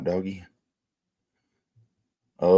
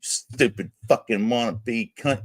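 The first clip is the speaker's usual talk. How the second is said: ordinary talk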